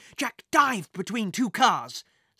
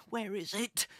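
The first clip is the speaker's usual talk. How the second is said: softer, muttered to himself; 3 semitones higher, 7 dB quieter than usual. A narrator's reading. Jack muttering to himself while searching his bag, soft. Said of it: "he muttered to himself".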